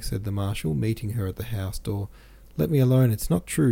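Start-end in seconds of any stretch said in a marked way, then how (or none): 2.6-3.7 loud, higher, angry outburst; angry cry. A narrator's reading. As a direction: cry it out angrily at him. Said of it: "she cried angrily at him".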